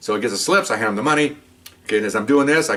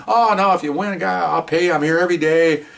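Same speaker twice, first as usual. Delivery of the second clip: eager bookie voice; 8 semitones higher than usual. Retold or imitated. Bookie reassures undercover bettor.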